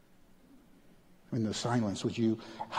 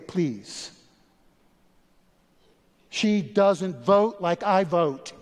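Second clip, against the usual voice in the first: preaching at full force; 8 semitones higher, 7 dB louder than usual. Acted. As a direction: hammer the point, slowing on key lines. Preaching against rejecting outsiders.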